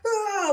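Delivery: high, loud mock killer's scream; screamed-out "ah", imitating a madman; imitated psychotic scream. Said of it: "going into the full".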